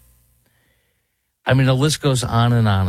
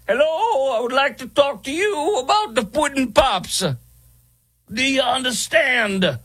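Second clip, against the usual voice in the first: much higher, put-on celebrity voice, slowing at the end; bad Bill Cosby impression; 14 semitones higher than usual. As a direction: do a deliberately terrible Bill Cosby. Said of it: "This is my horrible impersonation".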